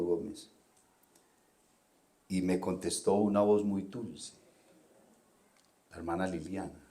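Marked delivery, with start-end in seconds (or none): none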